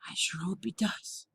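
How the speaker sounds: put-on whispered Spanish accent aside; hushed, hopeful Spanish accent. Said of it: "Can't do the Spanish accent the whole time".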